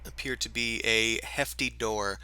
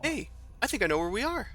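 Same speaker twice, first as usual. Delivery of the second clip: character voice; 12 semitones higher, faster than usual. Acted. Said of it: "kind of comes forward and goes".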